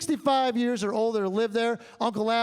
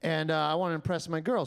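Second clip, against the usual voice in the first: ordinary talk, nothing marked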